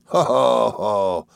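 a big hearty ho ho ho; mild hammed-up sailor's laugh. A narrator's reading. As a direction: laugh it out round and jolly. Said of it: "laughed Mister Peggotty".

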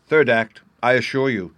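put-on loud, firm young soldier; merciless young warrior. A narrator's reading. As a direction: insist loudly, teasing mercilessly. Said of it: "said the young soldier mercilessly".

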